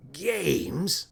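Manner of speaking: plain reading voice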